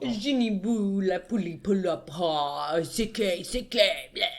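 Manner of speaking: hammed-up French accent nonsense; fake French. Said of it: "speaking fake French to someone".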